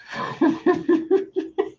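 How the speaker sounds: real laughter, squealing high; a laugh that runs away with her; high breathless laughing burst; high helpless laugh. Real, not acted. Laughing fit.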